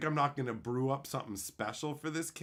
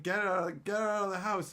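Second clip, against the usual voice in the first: higher mock outburst at a smell; mock outraged order; 6 semitones higher, 3 dB louder than usual. Carly imagined throwing him out over the stink, mock outrage. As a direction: order him out, lightly hammed-up.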